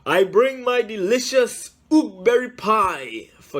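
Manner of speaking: loud, high call to the gatekeeper; yelled. A narrator's reading. Cook at the gate to the gatekeeper, yelling. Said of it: "yelled to the gatekeeper".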